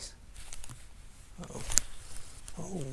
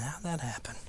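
whisper; 7 dB quieter than usual. Real, not acted. Muttered to himself.

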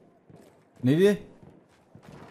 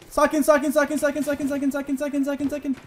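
high, rapid repeated muttering; panicked self-calming; 10 semitones higher than usual. Real, not acted. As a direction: chant it fast and high on one note. Calming self after scare.

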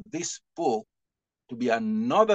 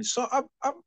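high, choked-up laughing admission; fighting back tears with a laugh; real emotion breaking through, high; emotional; 12 semitones higher, faster than usual. Real, not acted. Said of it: "I'm very emotional".

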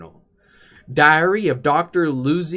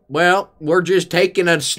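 ordinary delivery

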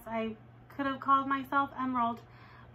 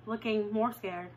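plain reading voice

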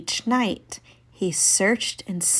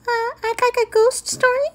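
very high squeaky mouse; tiny mouse voice; faster than usual. Acted. Mouse asks for story.

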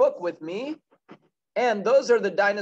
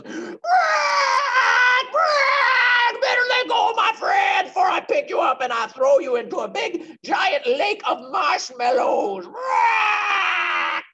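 very high, loud squawking dinosaur shout; squawking pterodactyl; 9 dB louder, slower than usual. Acted. Teacher voicing the pterodactyl rescuing the boy, high squawking shout. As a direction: squawk like a giant bird scaring off a bully. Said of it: "the pterodactyl comes behind and goes".

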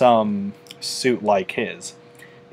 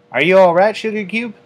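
put-on higher country-girl voice, raised in concern; Applejack voice; 9 semitones higher, 7 dB louder, faster than usual. A narrator's reading. Applejack asking nervous Fluttershy if she's fine, concerned. Applejack checking on scared friend.

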